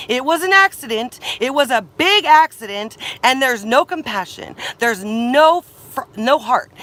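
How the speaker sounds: loud, high, furious rant; angry shouting. Real, not acted. Ranting at the campground staff, loud and furious. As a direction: yell your outrage at them.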